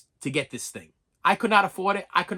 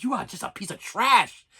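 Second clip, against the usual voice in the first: reenacted disgust, higher-pitched; indignant, raised-pitch telling-off; angry; 4 semitones higher than usual. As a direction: hurl the insult as if at the screen. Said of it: "how angry I used to get".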